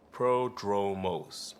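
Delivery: Prodromos drawn out very slowly, each syllable savored; savoring the name. Shown as a demonstration. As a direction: roll Prodromos out slowly like a grand title. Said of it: "Sounds good, doesn't it?".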